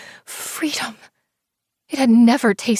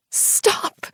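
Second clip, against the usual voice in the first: crying. Acted. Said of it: "I screamed and cried".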